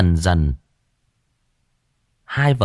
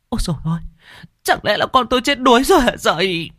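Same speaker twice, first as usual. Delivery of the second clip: high, wailing mother; wailing cry; 14 semitones higher, 3 dB louder, faster than usual. Acted. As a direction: wail it out for her drowned son. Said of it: "gào lên". (gào lên thảm thiết)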